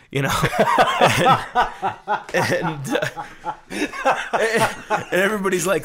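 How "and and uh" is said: bursts out laughing, high and loud; can't get the words out for laughing; real laughter. Real, not acted. Teller cracks up at own story.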